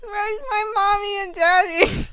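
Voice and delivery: high, loud, fast sobbing pleas; crying child. Acted. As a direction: whimper like a scared little kid calling for his parents. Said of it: "he cried".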